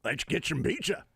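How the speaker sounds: playful mock impression; put-on character voice. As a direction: propose it in a goofy voice. Acting a solo night of voices.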